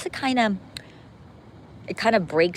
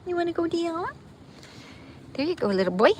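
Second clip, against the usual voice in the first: much higher sing-song pet voice; 13 semitones higher, faster than usual. Real, not acted. Asking her little boy dog if he wants down, high baby talk. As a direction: lift the pitch way up as if talking to a puppy.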